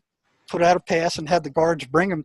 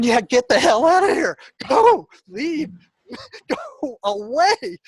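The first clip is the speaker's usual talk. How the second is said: shooing him out; 9 semitones higher, slower than usual. Retold or imitated. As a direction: chase him off in a raised, half-laughing yell. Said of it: "and I'm going".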